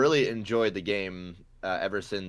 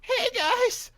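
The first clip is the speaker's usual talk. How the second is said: much higher, squeaky post-tonsils voice; high-pitched voice; 6 dB louder, slower than usual. Retold or imitated. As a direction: pitch way up like a new voice. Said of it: "it'll definitely get, uh, a higher pitch".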